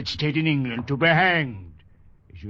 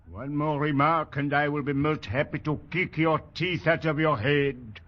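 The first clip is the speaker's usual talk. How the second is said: usual speaking voice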